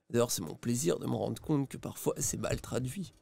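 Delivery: quiet, dry aside; Cassandre-style joke. Retold or imitated. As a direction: drop the voice and deliver it deadpan like Cassandre would. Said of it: "Ça, c'est une blague à la Cassandre".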